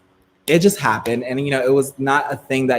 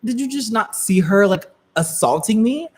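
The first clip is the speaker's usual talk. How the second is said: high, outraged disbelief; indignant reply to the DMV worker, pitched high; indignant protest; 8 semitones higher than usual. Retold or imitated. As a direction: protest as the wronged one. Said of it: "And I was like".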